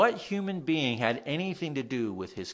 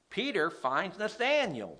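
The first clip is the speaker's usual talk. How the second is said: usual speaking voice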